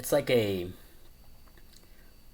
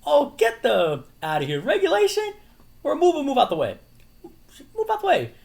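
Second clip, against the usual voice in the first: hammed-up squealing retort; 9 dB louder than usual. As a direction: shriek it in a cartoonish put-on voice. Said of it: "he's like".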